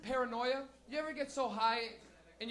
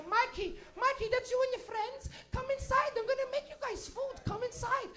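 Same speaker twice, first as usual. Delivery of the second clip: put-on high, friendly accented welcome; sweet immigrant lady; 12 semitones higher than usual. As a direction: greet an old friend sweetly. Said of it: "she'd go".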